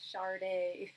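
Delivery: "[name]" as SHAR-day with a clear R; mistaken R pronunciation. Shown as a demonstration. Confessing her years-long mistake, "[name]" pronounced SHAR-day. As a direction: say "[name]" wrongly, R before the D. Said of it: "for years, I was referring to her as".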